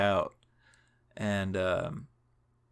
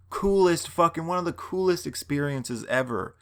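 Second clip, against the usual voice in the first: excited gushing; 7 semitones higher than usual. Real, not acted. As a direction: gush about it like it made your year. Gushing about their kindness.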